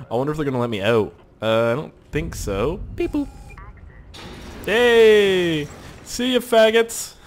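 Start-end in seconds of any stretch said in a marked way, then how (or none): 4.6-7.1 taunting shout. Pretend. Taunting the guards.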